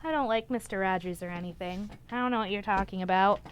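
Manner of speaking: deadpan aside, pretending not to be a fan; mock denial. Joking to viewers about loving Mister Rogers, soft muttered mock denial.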